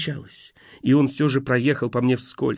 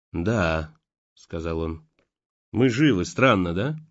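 lower, flat-toned detached reply; empty, indifferent; 6 semitones lower, slower than usual. A narrator's reading. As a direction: answer as if nothing matters. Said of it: "пустой, безразличный голос".

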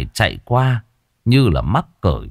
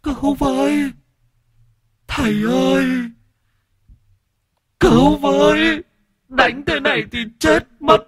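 terrified wailing. Acted. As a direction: scream in terror while being beaten. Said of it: "rồi gào khóc". (gào khóc hoảng loạn, rất cao)

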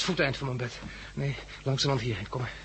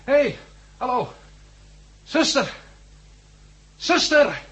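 high, loud shouts for the nurse; shouting for help; 11 semitones higher, 6 dB louder, slower than usual. Acted. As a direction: yell down the empty hall. Patient calling louder for nurse.

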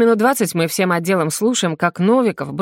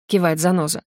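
ordinary delivery, nothing marked

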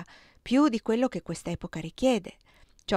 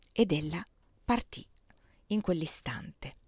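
whispered close; 2 semitones lower than usual. A narrator's reading. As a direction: breathe the last words out like a secret. Dramatic chapter close. (sussurrando, lento alla fine)

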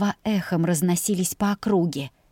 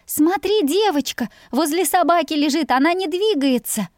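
put-on high, eager child's voice; a boy breathless with discovery; excited boy; 9 semitones higher, 3 dB louder than usual. A narrator's reading. Boy tells his father about the girl, high excited voice.

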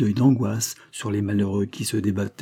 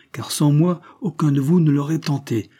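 higher-pitched assertion of authority; voice of command; 6 semitones higher, 4 dB louder than usual. A narrator's reading. Wilfrid claiming the rescue as his own, commanding. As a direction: assert your right to lead. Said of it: "de cette voix de commandement".

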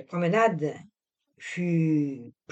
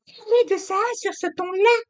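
put-on high young woman brushing it off; the wife cutting the talk short; young wife, impatient; 5 dB louder than usual. A narrator's reading. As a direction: hurry him out the door. Narrator voices Camille.

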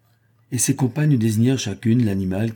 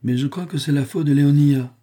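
higher, biting mistress voice; acerbic; 6 semitones higher, 4 dB louder than usual. A narrator's reading. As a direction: snipe at the clumsy maid, a little higher. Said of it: "d'un ton acerbe".

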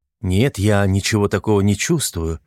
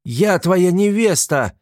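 high, slow indignant cry; angry shout; 10 semitones higher, 3 dB louder, slower than usual. A narrator's reading. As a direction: shout it with hurt fury. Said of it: "закричала рассерженная Агнеса".

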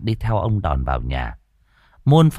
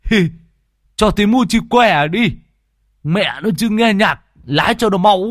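high, loud rowdy shout; calling out; 13 semitones higher, 5 dB louder than usual. Acted. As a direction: yell over the engine from the car. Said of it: "Lâm Sẹo ... hô".

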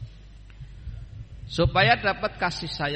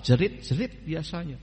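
ordinary talk

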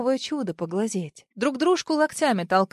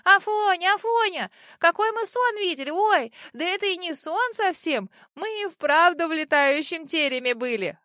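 put-on high, eager kids calling out; excited children's voices; excited children shouting; 7 semitones higher than usual. A narrator's reading. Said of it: "бегут к нему и кричат".

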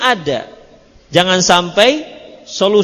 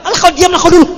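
higher, louder irritated snap; annoyed husband; 9 semitones higher, 4 dB louder, much faster than usual. Retold or imitated. Rattled husband snapping at his nagging wife, mock angry. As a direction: shut her up, fed up. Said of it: "bisa nampar lah kan".

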